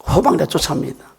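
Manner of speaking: normal talking voice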